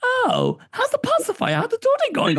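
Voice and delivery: very high, mocking singsong friend voice; teasing friends. Friends teasing parents about the pacifier, high mock voice.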